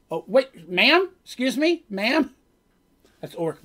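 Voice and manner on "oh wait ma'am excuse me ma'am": higher, louder, questioning call for attention; politely flag down someone walking away; calling after someone. Retold or imitated. Players call after departing Sphinx.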